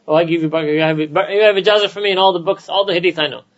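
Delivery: tumbling, lightly mocking quote of a scholar; ijazah-granting scholar. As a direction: casually hand out blessings in mock generosity. Mimics scholar handing out ijazahs.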